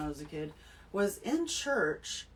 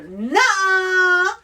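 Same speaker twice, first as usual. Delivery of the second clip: high, loud drawn-out refusal; defiant nuh-uh; 11 semitones higher, 15 dB louder than usual. Pretend. Child on the pew defying the preacher, loud high shout. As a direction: shout down the preacher, hand on hip.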